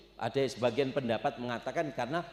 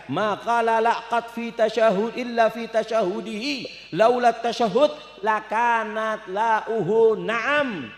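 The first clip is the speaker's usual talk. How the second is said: poem recital; 7 semitones higher, 7 dB louder, slower than usual. Shown as a demonstration.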